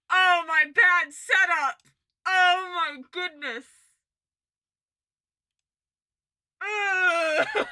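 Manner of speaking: drawn-out, high, loud groaned exclamations; loud cringing dismay. Real, not acted. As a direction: cry out slowly in embarrassed dismay. Cringing at own old clip.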